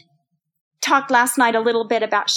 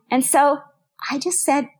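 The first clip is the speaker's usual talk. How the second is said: ordinary talk, nothing marked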